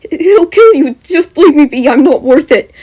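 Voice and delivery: high, loud, desperate fear; terrified voice. Acted. Mineta begs Jem not to fight, high terrified plea. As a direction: plead in panic for her to stop. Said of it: "in a terrified voice".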